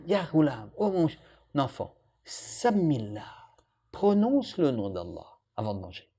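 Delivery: put-on quiet loving instruction to a boy; gentle. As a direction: teach the child kindly. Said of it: "avec compassion, avec amour".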